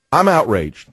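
normal talking voice

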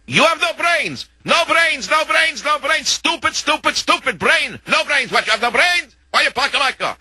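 very high-pitched ranting of an enraged Russian; mock Russian screaming. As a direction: imitate a livid Russian shrieking in broken English, without extra volume. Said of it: "screaming and yelling".

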